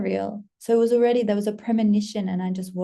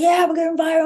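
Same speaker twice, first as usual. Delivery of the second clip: high, louder self-hype; excited boast; 8 semitones higher, 6 dB louder, faster than usual. Retold or imitated. Creator mimicking her own boast of going viral, excited. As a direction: cheer it high and loud.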